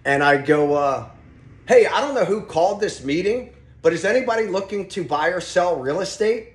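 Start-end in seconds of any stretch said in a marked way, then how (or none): 1.7-6.5 calling out to the line. Retold or imitated.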